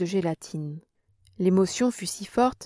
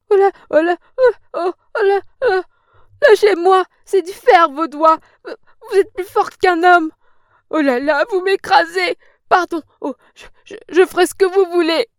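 crushed in pain; 10 semitones higher, 10 dB louder than usual. Acted. Hand crushed, old woman squeals.

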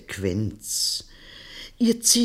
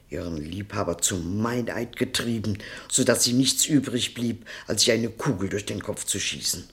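ordinary talk

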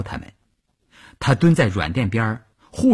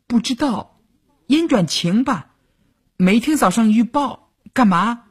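put-on high, uncertain little girl voice; unsure, pausing between thoughts; hesitant girl; 14 semitones higher, 3 dB louder than usual. A narrator's reading. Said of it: "犹豫地说". (小女兵犹豫地回答)

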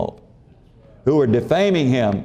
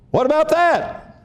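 high, eager questioner voice, much higher pitch; pleading questioner; 13 semitones higher than usual. Acted. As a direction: play a hopeful asker fishing for a loophole. Imagined questioner tries again.

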